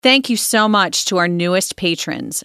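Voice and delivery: loud, upbeat promo read. Acted. Host reading the Patreon plug to listeners, upbeat promo voice. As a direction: sell it brightly to the listeners.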